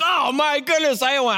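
high, hushed amazement; thrilled disbelief. Retold or imitated. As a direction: winner gasping to himself in delight. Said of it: "I was just like".

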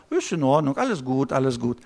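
low, soft, mock calm brushing it off; dismissive inner voice. Telling yourself all is fine, low mock calm. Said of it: "es geht hoch und ich sage".

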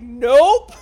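loud, far-off blurted nope; mock nope. Podcaster imitating Izzy refusing, loud blurted nope. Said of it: "Izzy's like".

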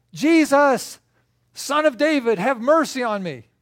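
high, loud desperate call; yelling. Acted. Blind man calls to passing Jesus, yelling. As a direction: yell out for mercy across a crowd. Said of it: "and so he starts yelling".

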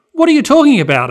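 put-on girl shocked to hear she must go on stage; surprised. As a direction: blurt it out in shock. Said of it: "a surprised Emerald said".

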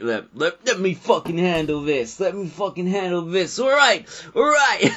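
high, agitated take-charge outburst, imitated; Mark Wahlberg. Film character taking over among convicts, mock-heated impression. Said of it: "and then Mark Wahlberg's like".

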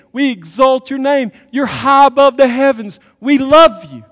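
building to a full high shout of praise; mock worship to an idol. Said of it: "what we're saying to the idol is".

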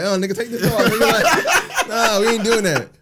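high, loud imitation broken by laughter; cracking up while mimicking her; laughing imitation. Mimicking the drive-thru worker through laughter.

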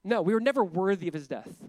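ordinary talk, nothing marked

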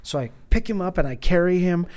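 ordinary talk

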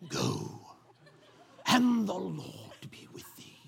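deep, slow mock-royal blessing; Darth Vader. King blessing David before battle, mock Darth Vader voice. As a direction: start very low and soft, stretching out each word like a hammed-up king. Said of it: "Kings have the Darth Vader voice".

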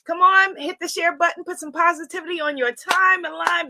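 ordinary delivery

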